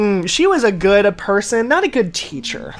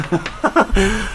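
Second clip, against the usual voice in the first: drawn-out mock British "What?"; posh British "What?". Friend hears her own British-sounding "What?" and laughs, drawn-out mock British. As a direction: say "What?" like a posh Brit, stretched out. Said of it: "Did you see how I said that?".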